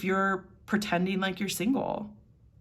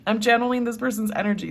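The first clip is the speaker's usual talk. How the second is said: giggling, a bit higher and louder; laughing; 5 semitones higher, 5 dB louder, faster than usual. Real, not acted. Reader explaining her giggles to viewers, laughing. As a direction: explain through your giggles. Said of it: "which is why I'm laughing".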